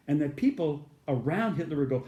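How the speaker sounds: normal talking voice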